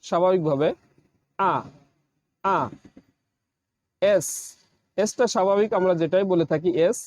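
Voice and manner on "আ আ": আ with no rolled r; teacher drops the r sound from আ; English R. Shown as a demonstration. Teacher models the letter R for learners. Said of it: "আজ থেকে আমরা বলবো".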